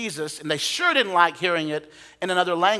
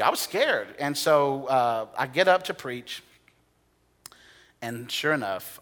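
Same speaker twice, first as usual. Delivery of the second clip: ordinary talk, nothing marked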